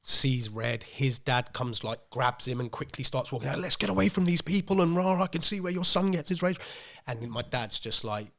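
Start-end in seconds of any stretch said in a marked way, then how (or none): 3.6-6.6 indignant parting shot. Retold or imitated. Other dad storming off, insulting.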